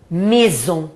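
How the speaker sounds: maison with ai said as e and a nasal ending; maison, mezon; French maison. Shown as a demonstration. Teacher showing students how ai sounds in maison. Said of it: "aí eu pronuncio E".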